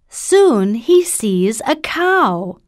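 usual speaking voice

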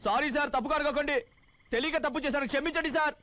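shrill comic flattery of the boss; grovelling apology. Acted. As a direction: beg forgiveness like a frightened subordinate. Cop grovels before brother-in-law.

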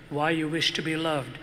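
normal talking voice

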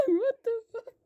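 high, breathy shocked laughing; surprised laugh. Real, not acted. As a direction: laugh in disbelief.

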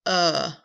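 loud clipped "uh" sound; isolated short u demo; vowel sound uh. Shown as a demonstration. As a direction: model "uh" plainly and clearly. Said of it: "Which vowel makes the sound".

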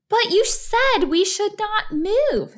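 high, agitated protest; distressed wife. A narrator's reading. Wife crying and arguing with husband, high distressed protest. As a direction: object in a high upset voice. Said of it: "said Missus Watson".

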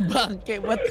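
cursing through a burst of laughter; laughing. Real, not acted. Player swears at being left solo in game, laughing. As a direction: laugh hard, then swear lightly.

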